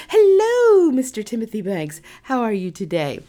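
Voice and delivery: high, loud, gushing hello; overexcited greeting. Retold or imitated. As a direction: burst out with a flustered, eager hello. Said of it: "I actually said".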